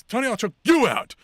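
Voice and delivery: retold screamed threat. Retelling a wrestler threatening Tony, mock yelling. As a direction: threaten in a high, loud imitation. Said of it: "her screaming, wailing".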